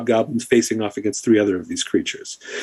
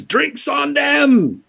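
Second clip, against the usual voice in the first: high, very slow gleeful taunt; rowdy hobgoblin; much slower than usual. Acted. Hobgoblin crowing as it kicks the creature, slow gleeful taunt. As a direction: crow it like a rowdy brute. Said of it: "and the hobgoblin says".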